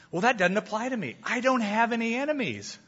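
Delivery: high-pitched mock protest; hammed-up self-excusing objection in a high voice; breezy denial. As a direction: play the listener waving it away. Acts out a dismissive listener's objection.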